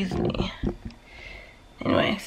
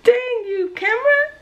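high, startled squeal at the camera; squeaky annoyed yelp; startled outburst; 5 dB louder than usual. Real, not acted. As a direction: yelp it as the camera catches you off guard.

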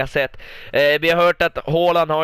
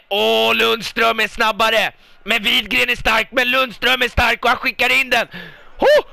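high, louder call climbing with the attack; excited play-by-play; 7 semitones higher, 4 dB louder than usual. Real, not acted. Commentator follows Lundström winning the duel, excited and rising. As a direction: ride the duel up to a shout.

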